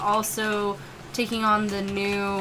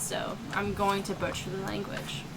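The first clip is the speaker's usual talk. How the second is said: normal talking voice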